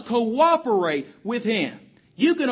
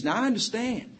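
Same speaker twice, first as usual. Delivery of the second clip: ordinary talk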